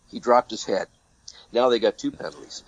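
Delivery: ordinary talk, nothing marked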